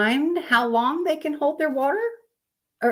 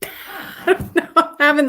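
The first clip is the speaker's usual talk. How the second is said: laughing; 7 semitones higher, 5 dB louder than usual. Real, not acted. Laughing at the image.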